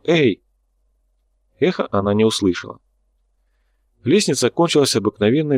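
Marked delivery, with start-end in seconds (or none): 0.0-0.4 слегка выкрикнутое «Эй!»; лёгкий оклик в пустоту, проверяя эхо; mild call. A narrator's reading. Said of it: "крикнула". (негромкий выкрик «Эй!»)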